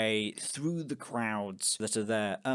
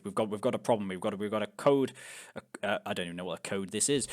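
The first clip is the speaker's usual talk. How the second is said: low, hurried radio report; shepherd on walkie-talkie; 6 semitones lower, 3 dB quieter, much faster than usual. Acted. Shepherd reports a problem on a walkie-talkie, flustered. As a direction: fumble through an urgent call, pitched low. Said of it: "begins talking to it".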